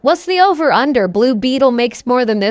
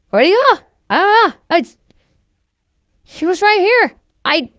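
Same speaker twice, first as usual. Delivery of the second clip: confused cops; 7 semitones higher than usual. Retold or imitated. Cops lose the suspect.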